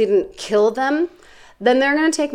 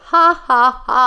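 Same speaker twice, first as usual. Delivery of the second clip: deliberate, spaced-out ha-ha-ha, pitched higher; spoken mock laugh; 4 semitones higher, slower than usual. Podcast host reads her own written note, spoken mock laugh.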